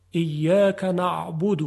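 ایاک نعبد very slow with a soft ain; soft ain demo. Shown as a demonstration. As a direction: draw out ایاک نعبد gently. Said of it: "صدای عین صدای نرمی است".

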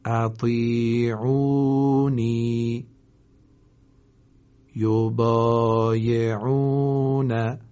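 slow, held vowels for repeating; model recitation. Shown as a demonstration. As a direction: recite clearly for students to echo. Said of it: "شما با من تکرار کنید".

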